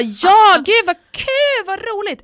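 high, loud drawn-out squeal of glee; hammed-up party excitement; clueless delight. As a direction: imitate an oblivious, overjoyed friend. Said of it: "hon fattar inte".

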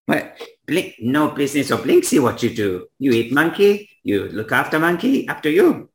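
high-pitched, broken-English shopkeeper; dismissive toymaker Blinksy. Acted. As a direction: wave off the objection in a high character voice. Voices Blinksy, toymaker figure.

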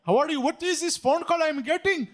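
high, loud put-on Indian accent. Shown as a demonstration. Prankster to phone scammers, loud Indian accent. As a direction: pitch it up in a mock Indian accent. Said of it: "What's your Indian accent sound like?".

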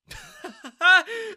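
high, loud scornful laugh; mocking laugh. Daniel laughs at the girl's claim, scornful laugh. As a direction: laugh in her face. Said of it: "Daniel started laughing".

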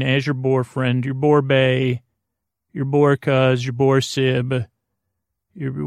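usual speaking voice

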